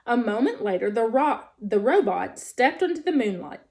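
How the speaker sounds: ordinary delivery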